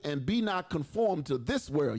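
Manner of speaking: slow, half-sung preacher reading; King James verse intoned slowly over music; extra scripture reading. Acted. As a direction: lift the verse like you're starting a hymn. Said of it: "I know that sounded a little extra".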